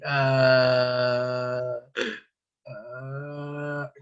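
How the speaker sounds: low drawn-out moan of pain, fading; patient moaning. Retold or imitated. Injured patient groans at the paramedic, low fading moan. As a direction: moan like a hurt crash victim. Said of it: "solo se está quejando".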